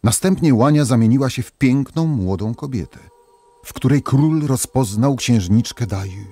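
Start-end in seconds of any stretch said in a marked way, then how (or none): none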